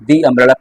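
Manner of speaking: "the umbrella" with the as "dee"; correct "the". Shown as a demonstration. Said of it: "ऐसे इसे बोलेंगे".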